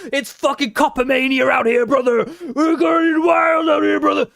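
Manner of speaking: high, hammed-up panic, building to a yell; mock alarmist. Streamer parodying police critics, mock hysteria rising to a shout. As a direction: wind up from brisk sarcasm into hysterical mock shouting. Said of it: "That's what they fucking think".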